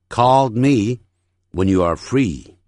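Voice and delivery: slow sentence drill with a pause midway; unhurried, separated phrases for repeating; phrase-by-phrase model. Shown as a demonstration. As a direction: model it in careful chunks. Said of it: "整句练习".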